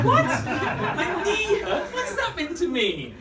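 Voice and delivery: high, loud, drawn-out disbelief; hammed-up baffled outburst; incredulous. Jumper answering own little voice, loud incredulous outburst.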